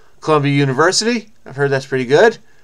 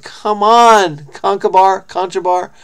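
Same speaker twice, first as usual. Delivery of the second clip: exasperated plea; 5 semitones higher, 3 dB louder, slower than usual. Real, not acted. Scolding a king from the story.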